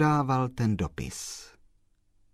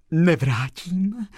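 breathed out; 9 semitones higher, 5 dB louder, slower than usual. A narrator's reading. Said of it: "vydechla slečna Marie".

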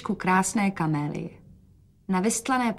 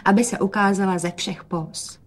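ordinary delivery

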